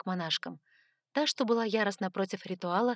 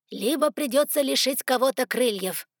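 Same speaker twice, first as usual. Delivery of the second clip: ordinary delivery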